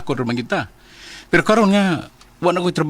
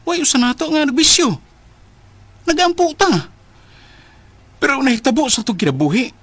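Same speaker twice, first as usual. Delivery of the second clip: anguished outburst; 8 semitones higher than usual. Acted. Rico to Trin, doubting God after all their prayers: rising anguished cry. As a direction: voice climbing higher and higher in despair. Rico despairs, questions God's existence.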